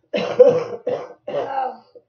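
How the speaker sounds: loud coughing fit; real loud cough. Real, not acted. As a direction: cough hard several times. Coughing fit mid-reading.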